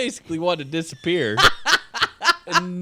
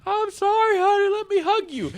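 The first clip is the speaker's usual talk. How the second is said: much higher, sugary consoling tone; sweet consoling voice; 4 dB louder than usual. Retold or imitated. Coworker mimics herself comforting upset Stephanie, high sweet voice. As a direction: coo it like comforting a crying friend. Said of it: "I'm just like".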